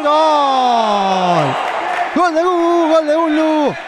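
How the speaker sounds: shouted goal call. Acted. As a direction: belt out the goal. Goal called in commentary.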